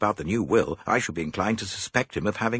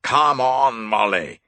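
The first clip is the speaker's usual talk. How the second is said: louder, furious call; enraged man shouting, louder; 4 semitones higher, 9 dB louder, slower than usual. A narrator's reading. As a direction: bellow her name in a rage. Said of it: "shouted Graham".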